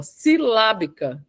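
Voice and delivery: "silábica" slowly, syllable by syllable; syllabic emphasis. Shown as a demonstration. Coach pointing out the technique she just used, syllabic emphasis. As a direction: stretch out "silábica", weighting each syllable. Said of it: "acabei de usar de novo".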